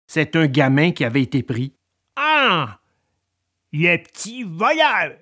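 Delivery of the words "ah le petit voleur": mildly angry peasant exclamation; acted, contained anger; angry farmer. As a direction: exclaim like a farmer catching a thief. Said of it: "s'exclama le paysan en colère".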